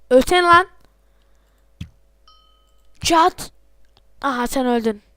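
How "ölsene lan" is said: louder angry yell; angry shout. Real, not acted. Gamer yelling at an enemy player, angry shout.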